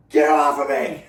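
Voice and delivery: explosive re-enacted shout; acted angry shout. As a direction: yell it as if shoving someone off. Re-enacting anger at teammate.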